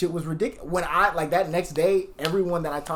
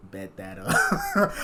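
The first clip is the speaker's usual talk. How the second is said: drawn-out; 7 semitones higher, much slower than usual. Retold or imitated. Retold pickup line.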